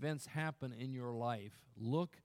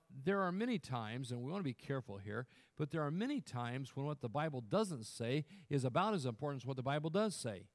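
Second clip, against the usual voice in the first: ordinary delivery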